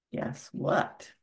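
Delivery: plain reading voice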